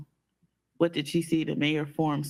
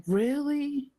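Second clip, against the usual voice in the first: incredulous; 9 semitones higher than usual. Retold or imitated. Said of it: "I was like".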